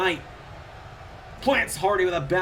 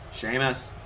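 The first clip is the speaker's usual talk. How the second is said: normal talking voice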